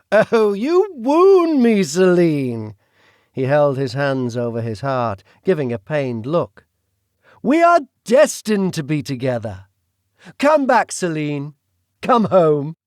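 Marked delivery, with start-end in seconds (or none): 0.1-2.7 British villain voice. Acted. The Duke speaks. 7.4-12.8 British villain voice. Acted. The Duke speaks.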